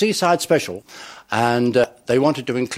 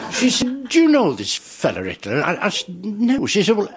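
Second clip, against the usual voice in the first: Rob Wilson impression; 6 semitones higher than usual. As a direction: play the bemused Home Guard man, unhurried. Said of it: "impressions of, of people like Rob Wilson".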